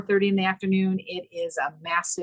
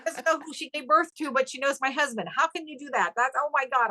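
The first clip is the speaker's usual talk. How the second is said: incredulous laughing; 7 semitones higher than usual. Real, not acted. Mother forgot her own daughter.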